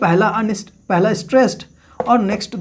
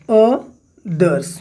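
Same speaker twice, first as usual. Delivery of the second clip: "others" split slowly into o, then thers; syllable by syllable; 2 semitones lower than usual. Shown as a demonstration. Teacher counting sounds for students, "others" broken into syllables. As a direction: say "others" in two separate beats with a clear gap. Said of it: "तो number of sounds count करिए".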